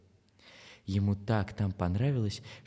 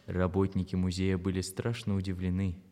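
ordinary delivery

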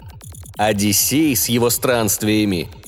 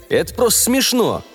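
objecting; 11 semitones higher than usual. A narrator's reading. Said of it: "возразил я".

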